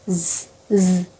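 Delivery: জ as a low quiet buzz; low, soft buzzing Z. Shown as a demonstration. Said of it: "next জি বা জেড". (নিচু নরম জ ধ্বনি)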